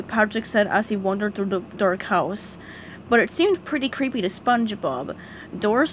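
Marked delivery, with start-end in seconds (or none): none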